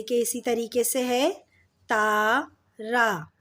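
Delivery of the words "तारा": तारा split into taa and raa, very slow; drawn-out syllables. Shown as a demonstration. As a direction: stretch the 'aa' vowels in तारा. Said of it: "इसमें भी अ का sound आ रहा है".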